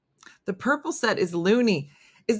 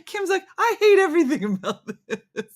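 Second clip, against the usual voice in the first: high-pitched, talking through laughter; helpless laughter under the words; laughing; 10 semitones higher than usual. Real, not acted. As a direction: crack up while quoting the grumble. Laughing at a friend's grumbling.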